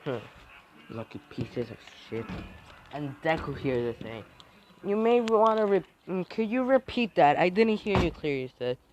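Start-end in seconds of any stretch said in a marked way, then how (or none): 0.1-2.3 muttered insult. Acted. 4.8-8.5 high, loud, sarcastically sweet request; mock-polite threat.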